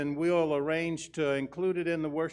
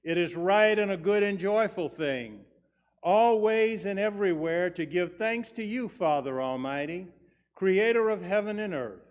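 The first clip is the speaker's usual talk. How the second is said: cathedral echo; 3 semitones higher than usual. Acted. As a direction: ring it out across the church. Liturgy intoned to congregation.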